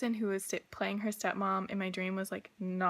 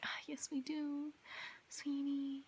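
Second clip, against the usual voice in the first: hushed, patronizing "oh sweetie" voice set higher; condescending whisper; 5 semitones higher, 10 dB quieter, slower than usual. Retold or imitated. Stepmoms dismissing her worries about the cabinets, patronizing whisper. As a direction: talk down to her in a breathy hush.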